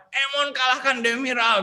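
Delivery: high, ringing imitation of a pupil's yell; mock shout. Pupil finally voicing his challenge to beat Demira, mock shouting. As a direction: call it out high, as a retold triumphant cry. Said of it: "Akhirnya dia teriak".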